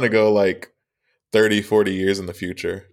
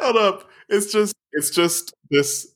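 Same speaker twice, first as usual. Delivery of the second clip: high hold up; 10 semitones higher, slower than usual. Real, not acted. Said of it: "startled interjection".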